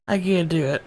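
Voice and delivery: ordinary talk, nothing marked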